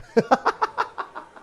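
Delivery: airy wheezing laugh; unvoiced cackle; breathless high laugh. Real, not acted. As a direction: burst out laughing at yourself. Laughs at own blunder.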